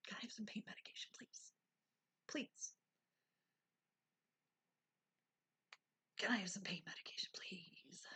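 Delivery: fast, soft whisper, begging politely; whispered plea. Retold or imitated. Patient in pain quietly begging the nurse for medication, whispered. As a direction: plead in a hurried whisper.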